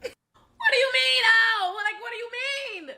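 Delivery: laughing disbelief. Real, not acted.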